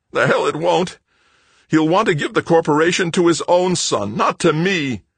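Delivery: loud, high, anguished outburst; frantic, wailing protest at high pitch; howled. A narrator's reading. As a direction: howl the grievance in outrage. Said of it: "Greg howled".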